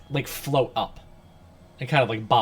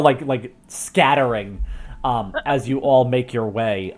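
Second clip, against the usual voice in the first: normal talking voice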